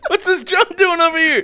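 loud, high-pitched comic demand; mock outraged shout. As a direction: yell it indignantly through laughter. Acts out angry film character.

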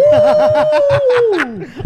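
loud burst of high laughter. Real, not acted. Big laugh at divorce joke.

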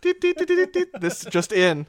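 a playful breaking-news announcer; news jingle. Pretend. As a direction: hum a high mock news jingle then announce.

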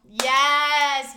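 long, loud, high yes; thrilled shout at a matching answer; excited cheer. Real, not acted. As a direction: shout a drawn-out victory yes.

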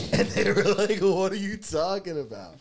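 high, squeaky laugh through the words. Real, not acted. Storyteller quoting the baffled staff, cracking up laughing. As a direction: barely get it out for laughing.

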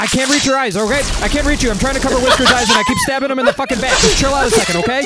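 high, rushed-sounding panicky explaining; flustered, high-strung shouting; frantic pleading. Acted. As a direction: plead over the screaming. Frantic explaining amid chaos.

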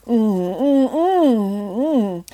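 muffled with a mouthful of butter; mouth full. Acted. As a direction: hum through a full mouth. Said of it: "the only noise they could hear from deep within the cannon".

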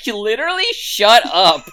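high, loud mock yell; retold shouted retort; Bright yelling. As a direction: imitate an exasperated outburst, hitting the last words hard. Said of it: "anytime Bright yells at Wong".

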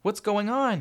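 put-on boy asking loudly, pitched high; worried young son. A narrator's reading. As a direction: call out as an alarmed boy. Child walks in on screaming.

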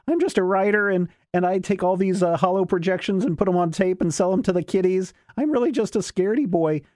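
high, whiny mock voice of a scared hero; mocking Questar. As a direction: squeak it out like a cowardly fake hero. Questar voiced in retold story.

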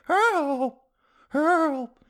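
put-on high old-lady voice calling help; a weak, far-off granny plea for help; grandma yelling again. Comic repeating the trapped grandma's high help cry. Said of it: "You know how grandmas yell".

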